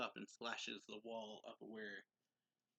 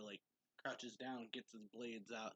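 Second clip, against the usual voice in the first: normal talking voice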